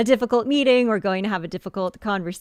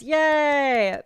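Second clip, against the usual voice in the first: excited cheer; 7 semitones higher, 5 dB louder than usual. Real, not acted. First piece sold on live stream.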